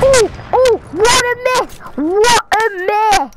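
high, loud sportscaster cry; mock announcer excitement. Real, not acted. Player mocks a missed shot, hammed-up commentator cry. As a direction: call it like a commentator.

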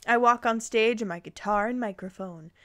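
normal talking voice

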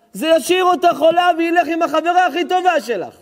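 high, loud fervent sermon; thundering preacher; full-force preaching. Acted. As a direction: preach it hard to the hall.

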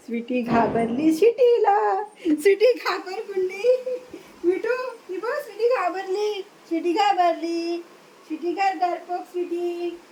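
playful coo, words stretched high; teasing pet talk. Real, not acted. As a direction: tease a scaredy pet in a lilting voice. Teasing frightened pet in singsong.

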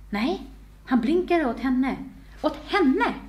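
high, incredulous delight; heroine's excited inner outburst; thrilled exclamation. A narrator's reading. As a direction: rise in pitch on a giddy realisation.

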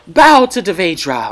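hammed-up command to kneel; imitating Zenobia's battle cry. Streamer mimicking Zenobia's freezing attack line, mock-grand. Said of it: "whatever the fuck she's saying".

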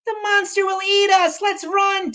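very high falsetto panic; hammed-up child in fright; frightened little one. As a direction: squeak a warning in a tiny voice. Tiny Toast panics.

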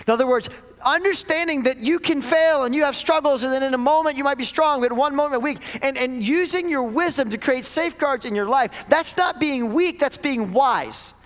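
heated sermon climb; impassioned preaching. Acted. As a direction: preach it with rising fire. Preaching at full force.

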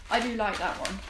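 normal talking voice

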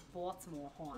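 imitated Baltimore local, hun as horn; Baltimore accent. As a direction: try out a slurred Baltimore accent. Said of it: "In Baltimore, they don't say hun".

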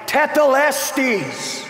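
high, loud and slow cry; drawn-out triumphant cry of Tetelestes. Retold or imitated. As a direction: cry the word out from the cross. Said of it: "When Jesus cried".

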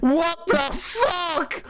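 slow high disbelieving; shocked. Real, not acted. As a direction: drag it out in shock. Drawn out shocked.

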